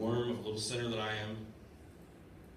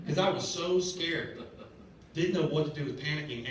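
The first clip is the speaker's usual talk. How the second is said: relived fear; 5 semitones higher, 4 dB louder than usual. Retold or imitated.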